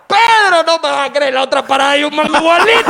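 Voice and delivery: high, loud happy yell after a slap; excited drunk shout. Acted. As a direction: bellow it like you've found your friend. Slap then drunk greeting again. (grito borracho de alegría, agudo)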